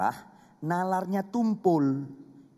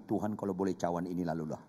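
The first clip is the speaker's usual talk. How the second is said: quick quiet prayer; 8 semitones lower, 7 dB quieter, faster than usual. Pretend. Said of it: "Boleh kau doakan Getsemani dalam hati".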